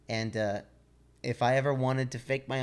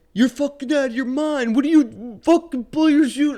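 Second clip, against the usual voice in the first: high, loud disbelieving yell; yelling buddy; 12 semitones higher, 10 dB louder than usual. Retold or imitated. The buddy yelling at him in free fall, loud and high. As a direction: yell it like he's lost his mind. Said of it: "And he goes".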